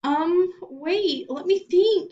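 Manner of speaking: high, nervous stammer. A narrator's reading. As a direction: stall for time, flustered. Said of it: "he stammered".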